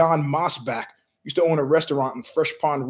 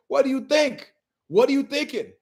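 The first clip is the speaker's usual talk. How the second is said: mock incredulity; 9 semitones higher than usual. Mocking developer, high.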